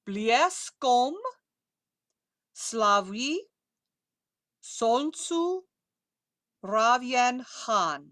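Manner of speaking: very slow, drawn-out Russian words; slow pronunciation model. Shown as a demonstration. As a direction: space out each word for repetition. Models next line word by word.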